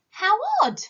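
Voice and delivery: high, loud cry of surprise; surprised exclamation. Acted. Mirabell gasps at the bubbles in surprise. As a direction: blurt it out, amazed at the bubbles. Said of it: "she exclaimed".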